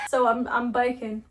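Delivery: usual speaking voice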